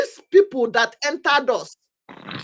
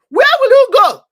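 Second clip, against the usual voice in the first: shouted high and loud, Where pushed hard; loud cry; 13 semitones higher, 12 dB louder than usual. Real, not acted. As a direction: hurl the question at them. Repeated rhetorical question, first take.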